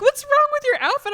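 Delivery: high, loud mom voice; high mocking moms. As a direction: squeal it like the moms. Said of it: "They were like".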